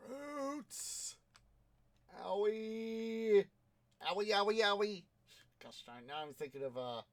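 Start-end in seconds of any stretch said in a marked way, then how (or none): 0.1-5.0 squeaky hurt voice, much higher and louder; cartoon ouch, pitched way up; high pained little voice. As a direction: whine like a tiny creature that got stepped on. In-game character hurt.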